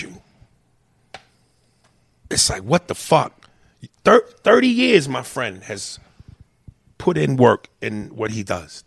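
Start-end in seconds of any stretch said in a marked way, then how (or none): 4.0-5.1 thirty years shouted high and loud; loud emphasis. Acted. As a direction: belt out thirty years in outrage. Repeats the years, loud then soft.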